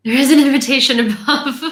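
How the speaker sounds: loud, quick words through laughter; real amused laughter in the voice; laughing. Real, not acted. Laughing while pointing out invitation.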